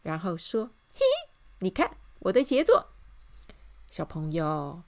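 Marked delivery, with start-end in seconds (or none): 0.9-2.9 high giggle, then proud announcement; pleased showing-off. A narrator's reading. Miss Optimist shows the king her sign, pleased. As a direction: present it with glee.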